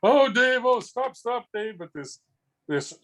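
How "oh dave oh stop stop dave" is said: high squeal of mock horror; a wife pretending to be appalled. Wife squealing at her husband's public prank, mock horror. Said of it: "she'd be squealing ... mock horror in her voice".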